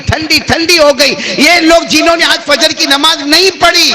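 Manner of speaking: high, sustained sermon delivery; full-force preaching. Acted. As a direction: declaim it high to a congregation. (بلند سُر میں پرجوش خطابت)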